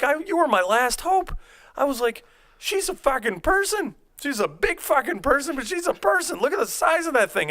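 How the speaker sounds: high, loud exaggerated whine; hammed-up high protest; mock protest. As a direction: play up the outrage in a high voice.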